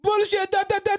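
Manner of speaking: high shrill mock of mom. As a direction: shout it like a scoffing mom. Mom mocked dismissing stepdad.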